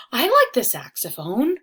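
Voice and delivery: excited cry. Acted. Riley exclaiming to his cousin about the saxophone, excited. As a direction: burst out with delight. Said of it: "Riley cried".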